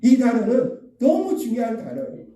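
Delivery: normal talking voice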